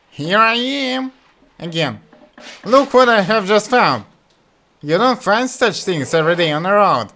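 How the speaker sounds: high, loud gleeful fool; excited Butthead. Acted. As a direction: show off your find. Butthead calls again.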